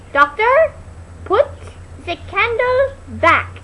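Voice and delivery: high, slow, muffled call; muffled from behind. Retold or imitated. Inga trapped behind the bookcase calling out, high and muffled. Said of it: "little muffled sound from behind".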